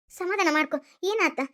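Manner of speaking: ordinary delivery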